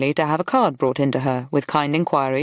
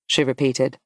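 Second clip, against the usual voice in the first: ordinary delivery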